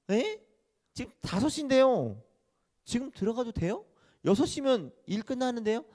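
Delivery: high, startled disbelief; can't believe the offer; surprised worker. Acted. Late worker startled by the owner's offer, high surprise.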